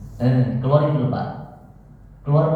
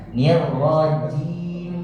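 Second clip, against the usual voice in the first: "nir" stretched long and slow, higher; drawn-out nir; 4 semitones higher, much slower than usual. Shown as a demonstration. Teacher showing the student a long "nir". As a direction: draw out "nir rajim" very slowly. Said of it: "Jangan ditekan, eh, desisnya".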